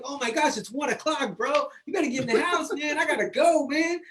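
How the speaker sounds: suddenly realizing it's way too late; startled realization. Retold or imitated. Telling his friend it's one o'clock, startled rush.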